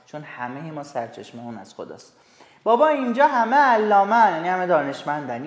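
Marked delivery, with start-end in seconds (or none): 2.6-4.3 louder, higher teasing praise; mock amazed. Student teases friends in mock awe, higher and louder. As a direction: act impressed by the know-it-all. Teacher voices an admiring student.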